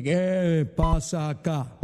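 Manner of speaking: slow, drawn-out devil voice; Lucifer. Acted. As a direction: stretch it like an ominous devil at the door. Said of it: "apareció Lucifer". (voz lenta y alargada de diablo)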